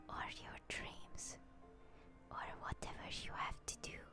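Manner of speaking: usual speaking voice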